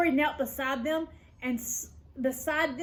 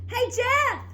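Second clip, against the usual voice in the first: loud, high call across the street; girl shouting to a boy to get his attention; shouted call; 10 semitones higher, 6 dB louder than usual. A narrator's reading. Lori calls out loudly to Jeff. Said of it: "Lori shouted".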